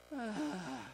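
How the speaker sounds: long, soft exhale; breathy acted sigh. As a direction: let out a long sigh in character. Acts the man's reaction to threat.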